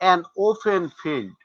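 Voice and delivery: an open field said very slowly, word by word; careful slow teaching example; slow demonstration. Shown as a demonstration. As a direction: spell it out slowly for the students. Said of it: "teacher demonstrating article use".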